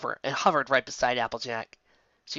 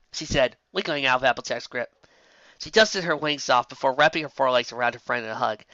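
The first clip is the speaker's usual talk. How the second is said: ordinary delivery, nothing marked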